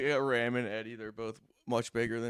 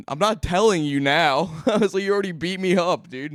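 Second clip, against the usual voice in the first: loud, raised-pitch mock protest; defiant protest; 6 semitones higher, 8 dB louder than usual. Beaten-up friend refuses to confess, loud mock protest. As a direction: replay a loud, indignant refusal through laughter.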